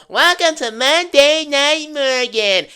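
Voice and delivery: high, slow, drawn-out imitation of Liv's catchphrase; mocking Liv Morgan. Wrestling vlogger mocking Liv's entrance line, drawn out and high. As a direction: stretch the welcome out like a smug champion. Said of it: "does her usual".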